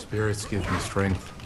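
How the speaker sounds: slow, lightly voiced warrior invocation. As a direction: call on the spirits, low and measured. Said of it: "your Indian person voice when you're playing".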